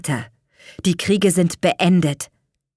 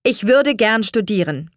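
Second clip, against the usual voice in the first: firm, raised and slightly forceful; 5 semitones higher, 5 dB louder than usual. A narrator's reading. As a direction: state the wish with resolve. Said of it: "sagte er mit fester Stimme". (mit fester Stimme)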